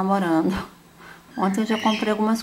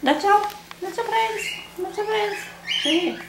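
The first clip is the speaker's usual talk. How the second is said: squeaky praising a dog; 12 semitones higher than usual. Real, not acted. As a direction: coo at the dog in a high voice. Talking to her dog on camera.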